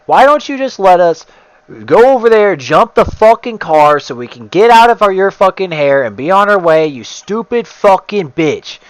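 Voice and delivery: high, loud yelling at the neighbor; furious yelling. Retold or imitated. Yelling at the neighbor who mouthed off to his mom, furious. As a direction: explode at her, voice climbing. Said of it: "dude, I just exploded".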